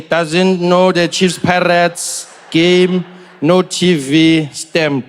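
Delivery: stretched slowly like taking notes; slow dictation. Retold or imitated. Official noting down Trevor's answers, very slow. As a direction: drawl it out as he writes.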